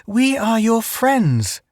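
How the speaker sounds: high, slow coaxing to a stranger; calm the wild native; slow soothing. A narrator's reading.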